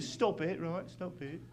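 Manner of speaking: soft, muttered British warning; understated mock British anger; mock angry Brit. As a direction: quietly mimic a cross British man. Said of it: "this guy in Vegas got so mad".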